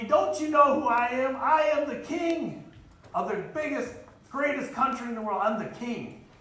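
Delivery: hammed-up tyrant demanding respect; playing the furious king Nebuchadnezzar, half in jest; angry king. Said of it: "But he was so angry".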